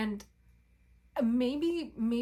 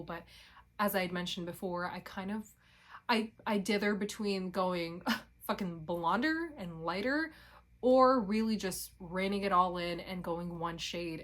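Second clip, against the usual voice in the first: ordinary talk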